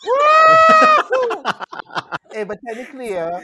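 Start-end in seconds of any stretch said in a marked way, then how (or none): 0.1-1.4 loud high whoop; excited cheer. Real, not acted. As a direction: cheer wildly. Cheering at the news.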